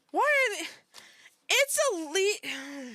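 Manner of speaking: high, loud, broken-off protest; outraged outburst. Real, not acted. Outraged that these websites are still up, high protest. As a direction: burst out, then break off mid-word.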